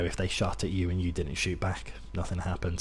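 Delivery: ordinary talk, nothing marked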